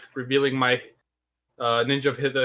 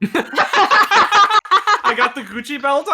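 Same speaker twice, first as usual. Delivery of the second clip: giggling through the words; laughing; 12 semitones higher, 9 dB louder, much slower than usual. Real, not acted. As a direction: laugh as you say it. Laughing while describing outfit.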